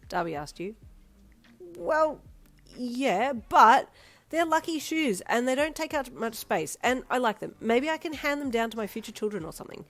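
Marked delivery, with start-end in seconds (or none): 1.8-9.8 high, loud defensive outburst; feeling attacked. Acted. Daughter defending her lucky shoes to Darby, outraged. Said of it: "feeling very attacked".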